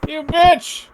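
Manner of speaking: high-pitched, hammed-up alarm; panicked outrage. Patient waking in a basement accuses doctor, panicked. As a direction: voice jumps way up in panicked confusion. Jack wakes captive in basement.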